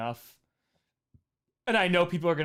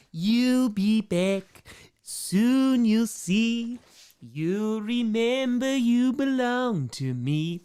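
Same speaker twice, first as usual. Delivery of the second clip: slow, high, put-on character singing; 5 semitones higher, much slower than usual. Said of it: "Take On Me in a Tome voice".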